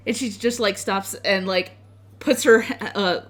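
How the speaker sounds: ordinary delivery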